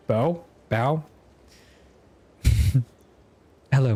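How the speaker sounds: normal talking voice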